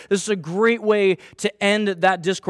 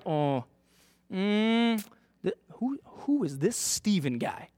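much softer, muttered mock confusion; mock puzzled crowd; 9 dB quieter, slower than usual. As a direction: play the baffled, irritated crowd quietly. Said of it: "they're almost like".

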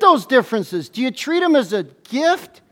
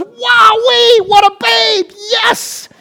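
much higher, louder hammed-up wowee; joyful astonishment; 11 semitones higher, 7 dB louder, much slower than usual. Pastor voicing Adam seeing Eve: hammed-up joyful whoop. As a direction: whoop it out like a smitten man. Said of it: "What Adam really said was".

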